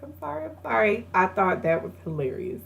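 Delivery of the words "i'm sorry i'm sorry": mock apologetic little voice; acted apology. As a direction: imitate a sheepish apology in a small high voice. Acting out apology with pacifier.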